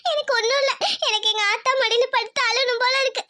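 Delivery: crying while speaking; weepy, with sobs between phrases; tearful. Acted. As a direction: talk through tears, longing for mother. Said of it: "இப்ப இப்படியே அழுகுறியே?".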